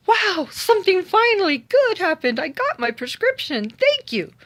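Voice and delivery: gasp, then a high, loud burst of joy; overjoyed. Retold or imitated. Tina thanking the pharmacist, high delighted gasp and squeal. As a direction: squeal with relief that something went right. Finally gets her prescription, overjoyed.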